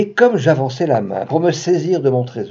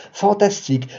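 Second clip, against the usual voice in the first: usual speaking voice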